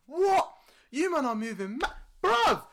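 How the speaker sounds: high, loud protest; outraged disbelief at being punished; retold outrage. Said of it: "I'm like".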